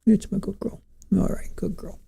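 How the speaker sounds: soft whisper to the cat; whispered praise. Real, not acted.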